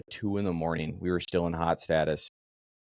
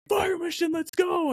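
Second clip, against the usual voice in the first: urgent call; slower than usual. Retold or imitated. Sergeant rousing sleeping gun crew.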